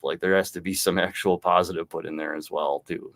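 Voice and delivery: ordinary talk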